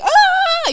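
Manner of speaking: high, loud mock scream imitating him. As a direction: shriek again like a startled driver. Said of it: "And then you were like".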